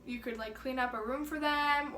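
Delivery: normal talking voice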